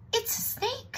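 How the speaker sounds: very high exclamation; chirpy mouse. Acted. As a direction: pipe up as if delighted. Mouse greets approaching animal.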